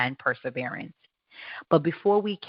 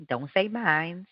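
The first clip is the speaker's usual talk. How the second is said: ordinary talk, nothing marked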